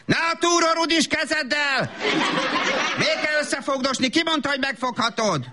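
high-pitched exasperated telling-off; scolding mother. Acted. Mother catches grubby hands on a skirt, scolding. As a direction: scold a child sharply in a high voice.

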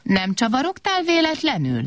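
stern. Acted. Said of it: "kérdezte szigorúan Kobak".

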